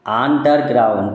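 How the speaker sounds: ordinary delivery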